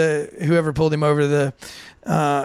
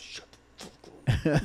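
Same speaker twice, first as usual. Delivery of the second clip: hushed, slow, soft hiss; driver hushing him; 4 semitones lower, 10 dB quieter, slower than usual. Retold or imitated. Driver hushing his drunk passenger, soft hiss. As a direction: hiss it to shut your friend up. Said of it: "The driver's like".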